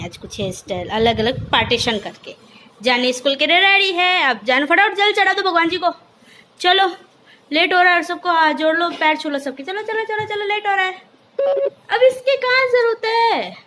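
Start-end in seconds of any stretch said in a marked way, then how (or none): 2.8-13.6 loud, high hurried calls; rushing everyone. Real, not acted. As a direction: hurry the whole family along. Rushing everyone, getting late. (ज़ोर से जल्दी मचाना)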